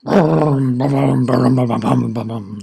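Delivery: loud booming then fading; volcano rumble. Acted. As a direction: boom like a volcano. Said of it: "says the volcano".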